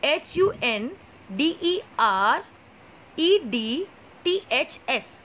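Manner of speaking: each letter of hundreds said slowly and apart; spelled out slowly. Shown as a demonstration. Teacher spelling the word for students, slow and even. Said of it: "hundreds की spelling क्या हुई?".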